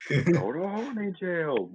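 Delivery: high, very drawn-out joke call; hammed-up high, slow meme voice; mock scolding. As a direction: sing out the silly sentence in pretend reproach. Joke meme line for bonking.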